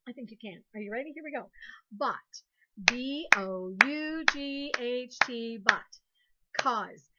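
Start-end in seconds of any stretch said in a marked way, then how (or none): 2.9-5.6 slow, letter by letter; spelling aloud. Shown as a demonstration. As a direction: clap out each letter slowly. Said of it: "clap a syllable".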